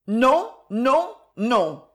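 cried refusal. Acted. Daughter refusing her father, cried refusal. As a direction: push the refusal out hard. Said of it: "s'écria-t-elle".

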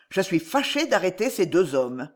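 usual speaking voice

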